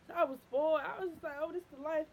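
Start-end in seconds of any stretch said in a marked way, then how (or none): none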